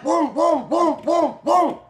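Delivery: high, punchy run of booms; imitated booming. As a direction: punch out each boom high, mimicking the wild party. Imitating the wild party noise.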